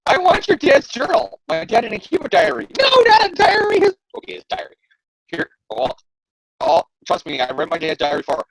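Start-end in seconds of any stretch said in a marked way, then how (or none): 0.1-4.8 high mock villain demand; squeaky cartoon-villain haggling; lightly hammed-up goblin demand. Hobgoblin and Harry bickering.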